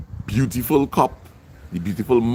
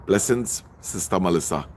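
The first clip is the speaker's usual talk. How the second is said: normal talking voice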